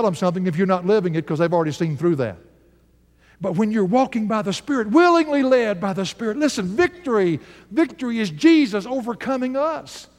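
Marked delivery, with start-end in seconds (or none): 3.4-10.1 high, rising preacher's cry; preaching. Acted. Preacher building to his people about victory, fervent. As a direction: lift it like a revival preacher.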